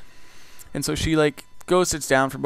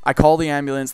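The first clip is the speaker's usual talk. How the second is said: usual speaking voice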